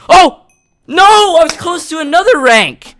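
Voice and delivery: high, loud cry of dismay; anguished shout. Real, not acted. Gamer who was close to another rank, anguished shout. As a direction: wail it loudly at a real near miss.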